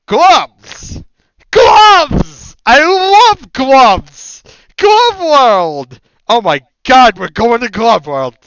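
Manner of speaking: high, loud, overjoyed screaming; ecstatic shouting. Pretend. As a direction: scream it like a thrilled kid. SpongeBob Glove World parody.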